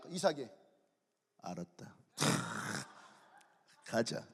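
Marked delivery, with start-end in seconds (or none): 1.4-4.3 very low, soft mock resignation; acted meek defeat; meek surrender. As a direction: give in with a small, defeated voice. Isaac meekly gives up.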